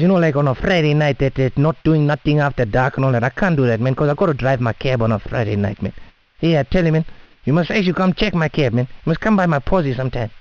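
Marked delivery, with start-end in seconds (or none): none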